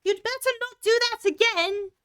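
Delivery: high, loud shouted warning; Alice shouting at the animals to stop. Acted. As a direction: yell it at them. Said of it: "She shouted out".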